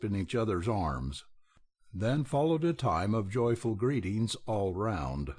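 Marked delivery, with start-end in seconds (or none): none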